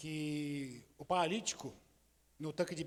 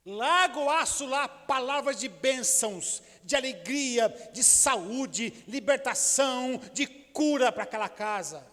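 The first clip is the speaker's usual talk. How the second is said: full-force preaching; 5 semitones higher, 5 dB louder than usual. Acted. Preacher proclaiming blessing over the congregation, fervent and loud. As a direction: proclaim it to the whole church.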